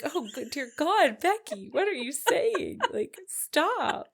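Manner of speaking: high, loud shocked exclamation; aghast, scolding a friend. Retold or imitated. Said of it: "and I was like".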